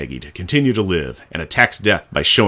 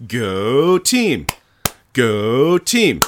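mock cheer; 4 semitones higher, much slower than usual.

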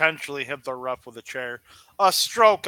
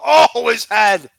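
loud, slow shocked cry; shocked outcry; 5 semitones higher, 11 dB louder than usual. Real, not acted. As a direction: wince and cry out at the hit. Reacting to a nasty bump.

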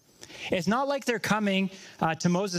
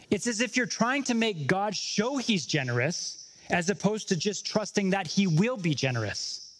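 usual speaking voice

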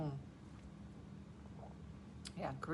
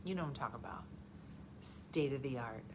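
ordinary delivery

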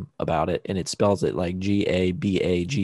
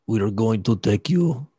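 put-on movie-villain Russian accent. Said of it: "Can you do Russian?".

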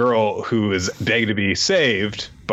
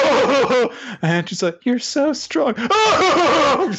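put-on swooning girl, laughing; much slower than usual. Girl grabbing his hand, swooning high through laughter. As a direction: gush high and lose it laughing.

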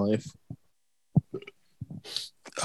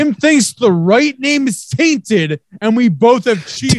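indignant yelling; 11 semitones higher than usual. Real, not acted. Heated cheating argument.

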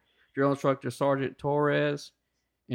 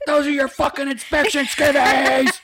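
much higher, louder, slow yell; mock furious instructor; 11 semitones higher, 7 dB louder than usual. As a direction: roar it slowly, outraged.

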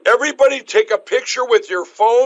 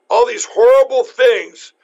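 ordinary talk

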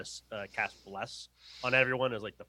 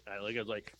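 ordinary delivery

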